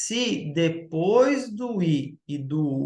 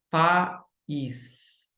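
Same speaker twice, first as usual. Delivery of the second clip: "país" split into pa, ÍS with a pause; syllable by syllable. Shown as a demonstration. Teacher showing an accompanied hiatus, "país" split into pa and ís. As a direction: sound out "país" as two separate beats. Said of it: "é país".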